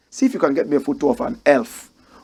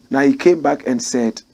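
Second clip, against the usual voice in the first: ordinary talk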